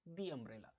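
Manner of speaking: "the" said "dee" before umbrella. Shown as a demonstration. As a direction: say "the" with a long ee, low and soft. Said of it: "इस द को हम क्या बोलेंगे".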